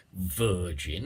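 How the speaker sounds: ordinary talk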